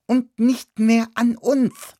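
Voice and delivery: high, slow squeaking voice; squeaky guinea pig. Acted. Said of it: "fiebte das Meerschweinchen".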